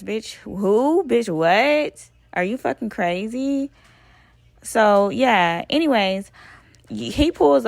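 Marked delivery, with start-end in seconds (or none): 0.0-3.7 indignant, louder retort; outraged disbelief. Retold or imitated. Speaking to viewers about the fight, indignant and louder. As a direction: tell the audience how absurd it was.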